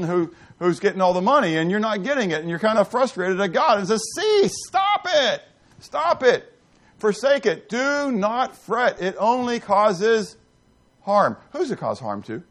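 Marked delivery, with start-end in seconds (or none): 4.2-11.4 each phrase slow and drawn out, starting much higher; stern command. Acted. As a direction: lay down God's command firmly, word by word. God rebukes the angry believer.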